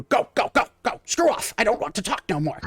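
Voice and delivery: high-pitched cranky shooing off; grumpy brush-off. Acted. Farmhand snapping at the barman to leave him alone.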